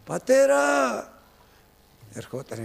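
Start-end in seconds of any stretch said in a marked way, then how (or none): 0.0-0.6 loud high call, stretched out. Shown as a demonstration. Preacher demonstrating to the congregation the child's call, loud high shout. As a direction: cry out for father again, as a demonstration. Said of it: "Προσέξτε το αυτό".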